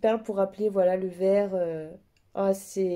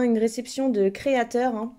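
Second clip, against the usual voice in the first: normal talking voice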